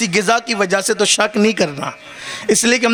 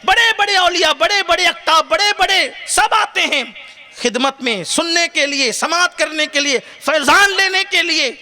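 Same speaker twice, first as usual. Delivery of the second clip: full-force preaching; 8 semitones higher than usual. Acted. Preacher praising Ghaus Pak to the crowd, impassioned high preaching. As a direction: raise the whole hall with rising fervour.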